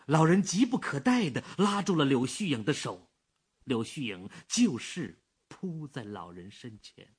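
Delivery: ordinary delivery, nothing marked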